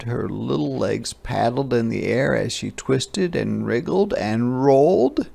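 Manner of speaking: plain reading voice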